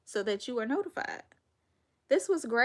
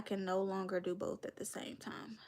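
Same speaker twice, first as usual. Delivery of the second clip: whispered; 7 dB quieter than usual. Real, not acted. Whispered aside about divorce.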